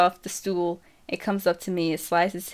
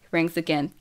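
ordinary delivery

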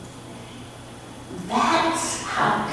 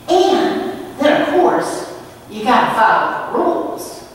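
high, louder bright explaining; chirpy clown lady; 6 semitones higher, 3 dB louder than usual. Retold or imitated. Clown lady explaining the rules to the boy, chirpy. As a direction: sell the rules like a cheerful clown.

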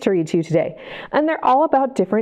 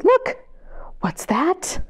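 whisper; 7 semitones higher, much slower than usual. Acted. Whispering suspense.

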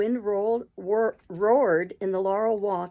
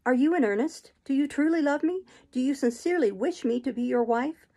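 higher-pitched, earnest questioning; Jane, hopeful and searching; 5 semitones higher than usual. Acted. Jane asks whether he truly loves her, hopeful and higher-pitched. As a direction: plead for certainty, each question rising.

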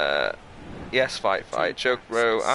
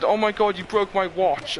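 mock whiny crying; 8 semitones higher than usual. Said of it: "He started crying".

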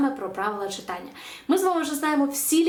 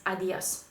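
«адіос» softly, as a-DYOS; marked-accent stress; 4 semitones lower, 5 dB quieter than usual. Shown as a demonstration. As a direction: stress the last syllable of «адіос». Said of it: "поставити наголос там, де він стоїть".

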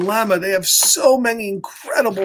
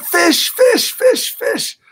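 high, loud, rapid-fire chant; excited child; 11 semitones higher, 4 dB louder, slower than usual. Acted. Kay announces the pet she wants, excited high cries. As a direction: squeal each word higher and punchier. Kay's excited choice in story.

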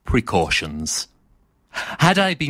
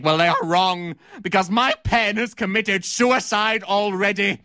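indignant tirade rising to a high finish; theatrical denunciation; 3 semitones higher than usual. Acted. Critic renouncing theatre to actor Teddy Cotton, outraged. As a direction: accuse the actor, building to a melodramatic climax.

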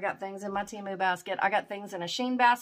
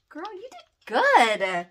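high, drawn-out gushing; high, slow, overjoyed approval; delighted praise; 7 semitones higher, 4 dB louder, slower than usual. Real, not acted. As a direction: gush praise, stretching the words. Praising the gift sender.

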